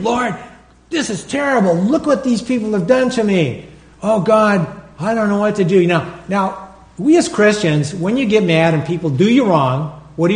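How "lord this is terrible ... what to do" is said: higher-pitched, worked-up plea of grievance; upset complaint to God. Retold or imitated. Preacher retelling his complaint to the Lord, upset. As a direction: retell a mad, exasperated prayer. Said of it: "I was mad. And I'm saying".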